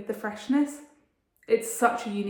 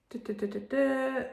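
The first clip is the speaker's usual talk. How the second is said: lips stretched while lining them; while putting on lip liner; 4 semitones higher than usual. Real, not acted. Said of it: "I can't talk and do lip liner".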